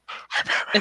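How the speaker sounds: soft voiceless laryngitis rasp. Acted. Repeating the ASMR example, laryngitic Batman. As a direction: whisper like a hoarse superhero.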